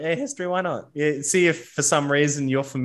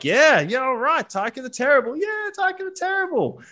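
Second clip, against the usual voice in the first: mock crowd chanting, pitched way up; cheering crowd; 13 semitones higher than usual. Crowd cheering the fighter into the ring, high excited chant. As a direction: hype him up like a roaring arena crowd. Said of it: "you hear the crowd's kinda like".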